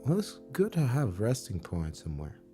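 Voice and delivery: normal talking voice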